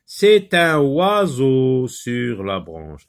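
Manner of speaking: slow, loud, drawn-out classroom reading; reading a sentence aloud. Shown as a demonstration. Teacher reads the first phrase to pupils, slow and loud. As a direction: read it out word by word, projected. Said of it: "on va lire la première phrase".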